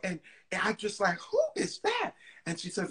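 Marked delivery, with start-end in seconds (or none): 1.2-2.1 high mock diva. Patti voiced in story.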